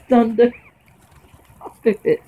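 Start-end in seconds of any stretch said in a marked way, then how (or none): 0.5-2.2 laughing stop it; being tickled. Real, not acted. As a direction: giggle and plead while tickled. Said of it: "That tickles".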